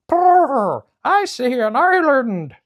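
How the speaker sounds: high, loud trilling bird cry; pheasant calling out. Acted. Pheasant calls from the ship to the crew, high bird cry. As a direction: sing out the sighting from the lookout. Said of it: "the pheasant called out".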